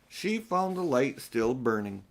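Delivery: ordinary delivery, nothing marked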